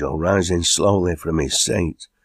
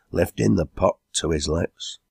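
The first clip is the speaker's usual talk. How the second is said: plain reading voice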